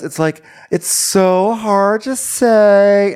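high, loud drawn-out wail; Victoria. Retold or imitated. Victoria hesitantly telling friends about a date, hammed-up high drawl. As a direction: overwrought voice stretching every phrase out slowly. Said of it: "So Victoria's like".

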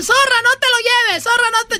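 high cartoon voice; lightly hammed kids' show voice; mock cartoon kid.

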